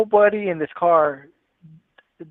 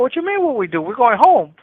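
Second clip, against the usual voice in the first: higher and louder, scoffing at the question; exasperated, raised voice shutting the question down; incredulous outburst; 6 semitones higher, 4 dB louder than usual. Retold or imitated. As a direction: brush off the panic with a loud, high retort. He scoffs at John's panic.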